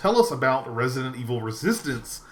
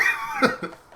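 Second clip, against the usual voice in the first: breathy laugh; 13 semitones higher than usual. Real, not acted. Laughing at own quote.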